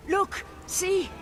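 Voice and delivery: high, panicked insistence; frightened, high-pitched urging; urgent plea. Acted. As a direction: plead to be believed at gunpoint. Pleads to be recognized.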